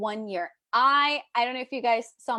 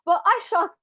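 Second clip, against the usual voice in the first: louder, higher mock-British; badly faked British accent, pitched up; terrible British accent; 6 semitones higher, 5 dB louder than usual. Coach carrying on regardless, terrible British accent. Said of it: "attempt at a British accent".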